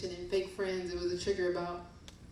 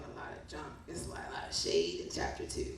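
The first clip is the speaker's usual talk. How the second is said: whispered aside; 12 semitones lower, 5 dB quieter, faster than usual. Real, not acted. Whispered aside about shade.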